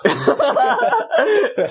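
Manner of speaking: squealing laugh; high burst of laughter. Real, not acted. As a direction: crack up laughing at the pun.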